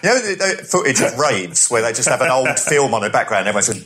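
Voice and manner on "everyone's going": usual speaking voice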